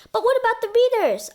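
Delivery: sudden high worried question; alarmed question. Acted. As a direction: blurt it out in alarm. Andy worried about the readers.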